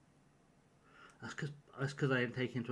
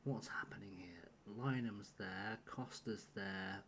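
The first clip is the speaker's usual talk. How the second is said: whisper; 4 semitones lower, 10 dB quieter than usual. Real, not acted. Streamer muttering over his lineup, whispered. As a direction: check the bench under your breath. Checking lineup under breath.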